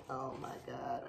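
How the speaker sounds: low, very soft OH MY GOD; hushed awe. Real, not acted. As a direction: breathe it quietly in amazement. Seeing the inside of the bag.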